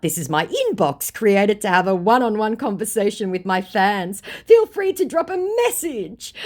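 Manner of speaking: high, loud, exaggerated fake-celebrity greeting; over-bright singsong mockery of a scam message; mock scammer posing as Teddy. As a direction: put on the fake star, swooping up high. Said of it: "Now meet the last Teddy".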